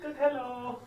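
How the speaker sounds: high, loud copy of the greeting; imitating high greeting. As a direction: echo it back high and loud. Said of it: "That's so cute".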